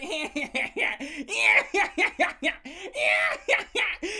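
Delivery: high, loud mocking cackle; hammed-up jeering laugh; taunting laughter. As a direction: laugh gleefully in someone's face. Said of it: "dancing around him laughing".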